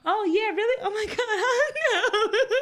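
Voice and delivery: high, laughing mock sweetness; imitating herself playing along nervously; fake cheerful play-along. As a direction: play dumb brightly. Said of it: "Like a freaking idiot".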